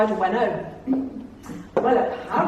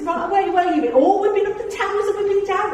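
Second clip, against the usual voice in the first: much higher, quick and bubbly; high chatty quote; 9 semitones higher, faster than usual. Retold or imitated. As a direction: rattle it off excitedly. Chatty exchange voiced high.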